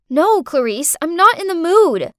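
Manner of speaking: shouted refusal. Acted. Carer refuses to play video games, shouting. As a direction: raise your voice to refuse a child. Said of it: "I shouted".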